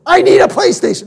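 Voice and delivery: put-on high, pleading kid voice for "I need a PlayStation"; mock kid nagging dad; whiny kid. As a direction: whine it like a child begging for a toy. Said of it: "as my kids go".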